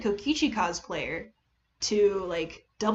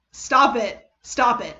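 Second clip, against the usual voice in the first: louder, laughing-off "stop it" at the chat; playful scolding; 7 dB louder, slower than usual. Real, not acted. Streamer telling teasing chat to quit, mock scolding. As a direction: shoo away the teasing, half-embarrassed.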